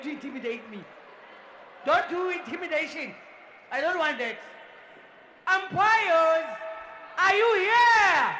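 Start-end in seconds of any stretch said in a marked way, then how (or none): 5.5-8.4 loud, high, slow shout; calling out. Real, not acted. MC asks umpires to answer, shouting. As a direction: stretch the roll-call out loud.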